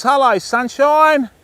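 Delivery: high, drawn-out singsong hello; playful greeting. Real, not acted. Angler greeting the fish he spotted, playful high drawl.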